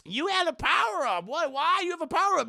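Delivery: high-pitched mock disbelief; incredulous. Villain stunned by sudden power-up.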